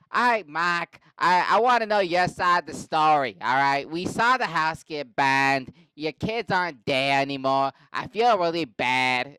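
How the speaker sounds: loud, hammed-up Boston cop; yelling Boston police chief. Police chief addressing Mark after the fire, loud Boston bellow. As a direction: bellow it in a mock Boston accent. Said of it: "they're all yelling at each other".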